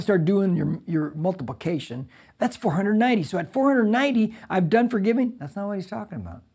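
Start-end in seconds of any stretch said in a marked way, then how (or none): none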